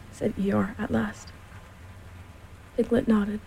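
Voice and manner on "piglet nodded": ordinary delivery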